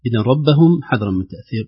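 usual speaking voice

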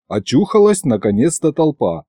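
ordinary delivery

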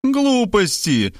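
high, drawn-out dismissal; decisive outburst. A narrator's reading. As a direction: explode in high, slow scorn. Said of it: "решительно взорвалась мать".

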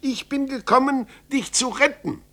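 put-on high, loud young voice; eager boy's cry. Son crying out to his father that he came to save him. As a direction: declare the rescue boldly. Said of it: "rief Zäpfel aus".

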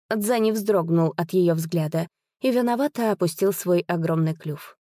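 ordinary delivery, nothing marked

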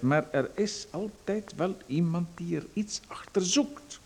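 slow, hushed whisper of a warning; confiding whisper. Acted. Visitor warns the writer, in a slow secretive whisper. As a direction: lean in and breathe the warning.

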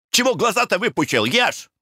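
high-pitched wifely scolding; scolding cry. A narrator's reading. Wife snaps at her staring husband, scolding cry. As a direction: cry out shrewishly, pitched well up. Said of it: "крикнула она на мужа".

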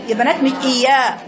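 normal talking voice

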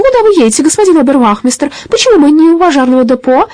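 put-on high, loud old lady's alarmed questions; an old woman crying out in alarm; alarmed old granny. A narrator's reading. Granny calling to the robber ahead, alarmed.

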